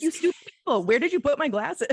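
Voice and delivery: loud, high exaggerated blame; hammed-up indignant demand at the whole household; mock accusation. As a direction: mimic an insulting, impatient teen. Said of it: "You make everyone around you feel stupid".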